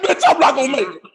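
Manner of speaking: quick, breathless comic panic; hammed-up fear; mock panic. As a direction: rush it like you're doomed. Panic before hot wing.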